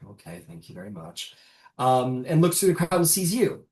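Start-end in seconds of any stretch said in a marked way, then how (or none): 0.0-1.4 low, very soft modest thanks; humble thanks. Acted. Changeling bowing to the crowd, quiet humble thanks. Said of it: "very humbly".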